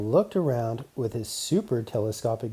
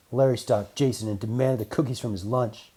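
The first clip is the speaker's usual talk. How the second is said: ordinary delivery, nothing marked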